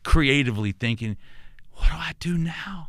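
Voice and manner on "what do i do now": hushed, breathless whisper to his dad; imagined David whispering in awe; whispered wonder. Retold or imitated. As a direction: whisper it like a bewildered boy. David imagined, anointed, unsure.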